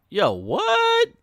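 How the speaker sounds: high, loud jokey voice as the guy playing it cool; mock cool guy. Guy brushing off the woman, mock cool high voice. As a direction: pitch way up for a goofy yo, what. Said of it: "He's like".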